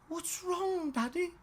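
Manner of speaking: put-on high small girl's voice, worried; a little girl asking her dad what's wrong; little daughter's voice. Worried little girl's high voice as she asks daddy what's wrong.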